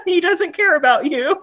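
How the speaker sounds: high giggly warning; laughing. Pretend. Mock advice continues.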